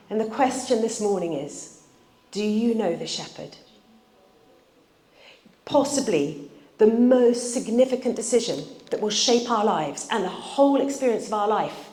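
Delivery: usual speaking voice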